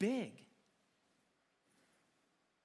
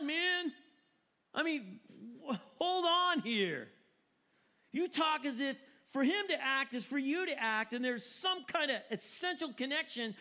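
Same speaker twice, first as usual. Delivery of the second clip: much higher and louder, exasperated objection; indignant protest; 5 semitones higher, 3 dB louder than usual. Acted. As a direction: throw it back at him, scandalized.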